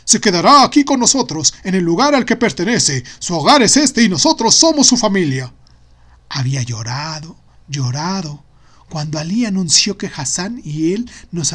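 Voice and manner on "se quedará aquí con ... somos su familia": loud, forceful declaration; bellowing father. Acted. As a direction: proclaim it like the head of house. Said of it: "había vociferado Baba".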